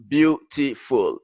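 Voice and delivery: "beautiful" broken into three separate syllables; split into syllables. Shown as a demonstration. Teacher showing learners that beautiful has three syllables, syllable by syllable. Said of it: "beautiful makta thalatha".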